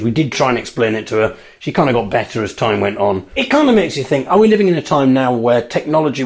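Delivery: normal talking voice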